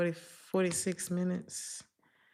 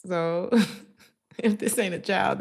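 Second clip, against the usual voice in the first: ordinary delivery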